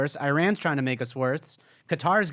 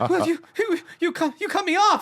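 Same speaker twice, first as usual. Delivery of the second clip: scared stammer; 5 dB louder than usual. Retold or imitated. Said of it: "I go".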